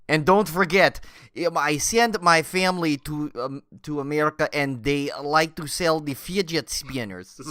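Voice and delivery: halting Russian sales pitch; comic Russian, slowing at the end; mock Russian accent. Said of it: "turned off our entire Russian audience".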